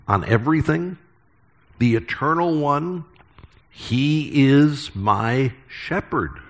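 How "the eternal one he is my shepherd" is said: higher, very slow, full of wonder; hushed awe. Shown as a demonstration. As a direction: let each word land in reverent awe. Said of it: "we should read it with a sense of awe".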